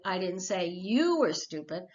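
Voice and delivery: you lifted and leaned on; I didn't say YOU were stupid, you stretched; stress on you. Shown as a demonstration. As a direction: hit the word you. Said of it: "where do you put the accent".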